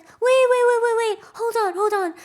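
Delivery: loud, high, repeated frantic interruption; mock-panicked pleading, easing slightly; acted panic. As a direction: play Curly panicking to halt her. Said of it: "like, panicked".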